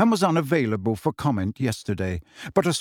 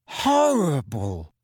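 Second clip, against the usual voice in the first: shaken; much slower than usual. A narrator's reading. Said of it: "looking shaken".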